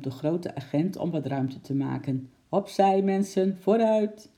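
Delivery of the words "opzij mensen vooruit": loud, higher call to the crowd. Acted. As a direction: bark it at the onlookers. Policeman pushing through the crowd.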